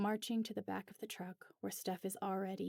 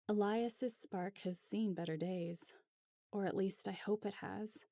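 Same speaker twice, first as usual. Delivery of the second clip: usual speaking voice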